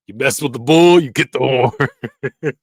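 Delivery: loud mock tough threat, slowing to a laugh; eighties threat. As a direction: bark the threat, then drag it out laughing. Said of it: "That was the threat back in the eighties".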